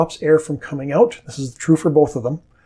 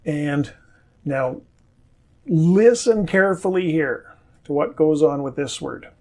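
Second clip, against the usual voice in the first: normal talking voice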